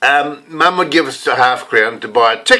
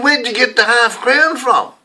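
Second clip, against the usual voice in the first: curious mum; 9 semitones higher than usual. Retold or imitated. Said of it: "She said".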